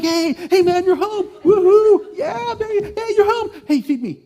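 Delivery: a big dog's ecstatic greeting, way up high and loud; excited dog. Retold or imitated. Dog greeting owner at the door, wildly excited high yelps. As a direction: bounce off the walls like a lab whose owner just got home. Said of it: "they're exuberantly excited".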